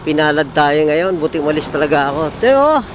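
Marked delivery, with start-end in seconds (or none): 2.4-2.8 high loud call. Real, not acted. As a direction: call out to him. Calling out loud.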